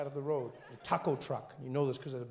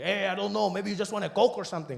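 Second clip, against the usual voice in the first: raised, loud vendor voice; taco truck vendor; 5 semitones higher, 5 dB louder than usual. Retold or imitated. Taco seller answering the customer, loud mock vendor. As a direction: call it out to the customer. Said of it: "He's like".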